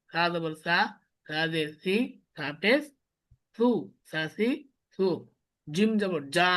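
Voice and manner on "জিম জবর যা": জিম জবর যা with a hard, firm জ; hard jim. Shown as a demonstration. Teacher demonstrating a hard জ to the class, firmly. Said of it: "শক্ত।".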